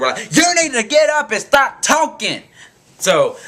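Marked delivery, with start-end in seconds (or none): 0.3-2.4 high, loud group yell, acted out; retold yelling. Bus riders to the girl who won't stand, acted yell. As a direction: yell it sharply up high, imitating fed-up riders. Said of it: "about to start yelling at her".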